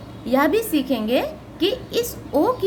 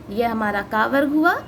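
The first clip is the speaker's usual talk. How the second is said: ordinary talk, nothing marked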